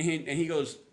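ordinary talk

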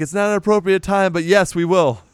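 high, quick, flustered brush-off; flustered author. Retold or imitated. Stein answering Hannah's flirting, flustered and quick. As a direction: caught off guard but agreeing. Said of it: "And he's like".

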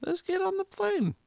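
high squeaky voice. Pretend. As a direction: squeak it out like a game. Sudden high pretend boarding.

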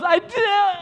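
high, loud retort cut short; stopped halfway. Retold or imitated. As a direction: start a mock protest, then halt midway. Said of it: "And just kinda stopped, like, halfway".

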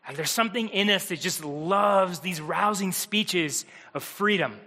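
normal talking voice

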